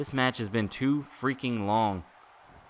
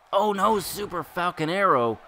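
alarmed outcry; 8 semitones higher, 4 dB louder than usual. Real, not acted. Opponent hits big move.

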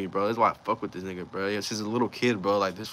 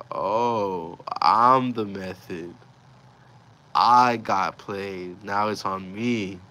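very slow, drawn-out words; dawning realization; 5 dB louder, much slower than usual. Retold or imitated. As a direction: stretch it out as it sinks in.

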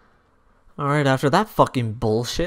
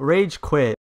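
ordinary talk

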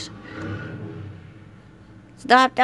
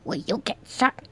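ordinary talk, nothing marked

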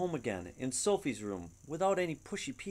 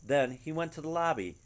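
ordinary delivery, nothing marked

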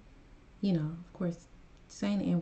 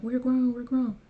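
higher, louder mock whine of grown people; mocking quote; 5 semitones higher, 6 dB louder than usual. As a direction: parody their defensive cry, louder. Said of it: "people like".

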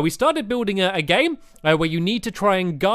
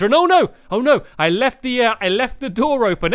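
alarmed oh no; 5 semitones higher, 3 dB louder than usual. Real, not acted. Left door open.

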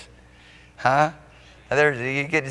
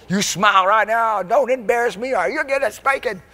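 scolding Mama; 10 semitones higher than usual. Retold or imitated. Mama warning her son at church, stern and high. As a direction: warn the boy like a strict mama. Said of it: "like Mama said".

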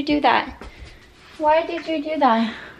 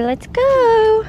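cheery prompt; 9 semitones higher, 6 dB louder than usual. Shown as a demonstration.

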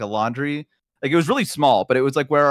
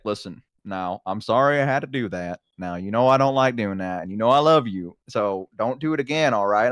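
lower, gentle parental talk; retold consoling dad; 5 semitones lower than usual. Dad coming into kid's room after spanking, calm reassurance. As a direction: reassure a child after punishing them.

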